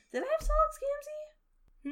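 genuinely baffled high voice; shocked high-pitched question; startled squeak. Real, not acted.

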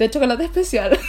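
De chocolate especial with a laugh, leaning on especial; hint at something with a giggle; knowing, insinuating. Shown as a demonstration. Dropping a hint to the audience, giggling and insinuating. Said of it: "Se tenía que insinuar nomás".

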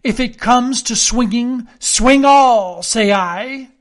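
loud, high fighting challenge; crying out again. A narrator's reading. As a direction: throw down the threat before blades are drawn. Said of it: "and again".

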